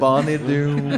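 savoring the name. Shown as a demonstration. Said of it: "C'est quoi le quartier franco?".

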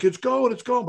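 high-pitched imitated insistence; mock yell. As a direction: shout it's fine, it's coming. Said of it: "he kept yelling".

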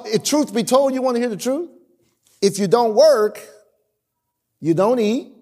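ordinary talk, nothing marked

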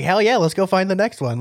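high-pitched, pumped-up agreement; retold excited buddy; fired up. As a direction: fire back an eager reply. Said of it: "I was all fired up".

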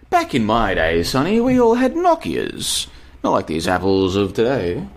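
put-on creaky old man scolding the kids; old boomer voice. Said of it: "I sound like a freaking boomer".

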